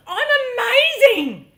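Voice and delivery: high, loud, exultant cry; triumphant. Retold or imitated. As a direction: crow it with pure elation. Acts triumphant thought.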